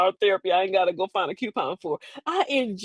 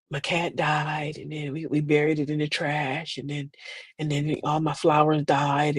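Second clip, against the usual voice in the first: mock gloom; 5 semitones lower, 5 dB quieter than usual. Mocking gloomy family check-in.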